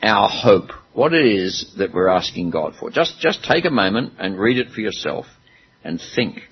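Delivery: normal talking voice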